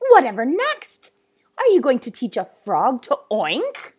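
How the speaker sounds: loud, indignant tirade; enraged. Acted. Zoo owner listing boy's offences, enraged. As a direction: build in outrage to a high shout. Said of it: "face was red with rage".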